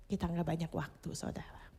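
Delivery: low, hushed warning sinking to a whisper; solemn warning. Real, not acted. Preacher warning the congregation that time is short, hushed. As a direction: lean in and warn them quietly.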